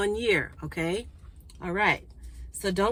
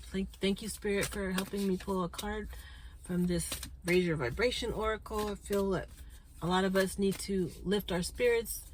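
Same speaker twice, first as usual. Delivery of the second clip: soft prayer; 5 dB quieter than usual. Acted. Prays to Spirit for a card.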